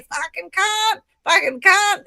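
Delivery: high-pitched Australian pub yobbo; broad mock Aussie, pitched right up. Showing how people in pubs speak, high Australian yobbo voice. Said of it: "a lot of people speak like this".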